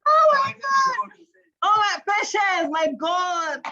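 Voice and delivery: high, loud, laughing exclamation; can't believe what she's reading; squealing amused shock; delighted disbelief. Real, not acted. Reacting to answers, laughing.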